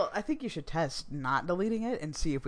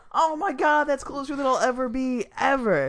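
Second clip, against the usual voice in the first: amazed; 10 semitones higher, 8 dB louder than usual. Real, not acted. Stunned by the close guess.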